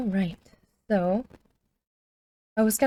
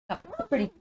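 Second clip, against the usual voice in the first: high pet-talk voice; 10 semitones higher, 5 dB quieter, much faster than usual. Real, not acted. Praising dog doing trick.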